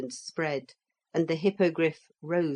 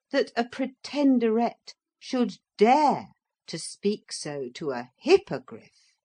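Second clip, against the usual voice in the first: ordinary delivery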